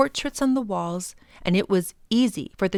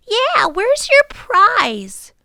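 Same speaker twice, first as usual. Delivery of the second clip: put-on much higher, louder, slow little girl; a small girl asking bluntly; high-pitched child voice; eager little girl; 14 semitones higher, 9 dB louder, much slower than usual.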